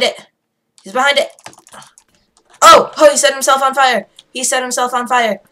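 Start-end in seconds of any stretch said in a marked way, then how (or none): 2.6-5.4 loud, high whoop of surprise; excited shout. Real, not acted. Player watching enemy burn himself, excited shout. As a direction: yell it out, amazed.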